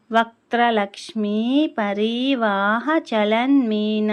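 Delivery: వక్త్రలక్ష్మీ పరివాహ చలన్మీనాభలోచన said slowly, each syllable clear; careful recitation. Shown as a demonstration. Teacher demonstrating the name syllable by syllable, slow and clear. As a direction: spell out every stressed letter slowly. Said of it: "ఒత్తులు దీర్ఘాలు చూస్తే".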